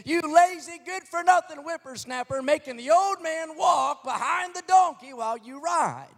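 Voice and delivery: high, loud berating shout; angry townsmen shout. Acted. Men of the town berating the boy on the donkey, furious high shout. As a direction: yell down the lazy boy with contempt.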